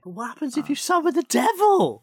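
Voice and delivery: high, eager question; excited. Real, not acted. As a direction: ask it with rising excitement. Playful question about summoning devil.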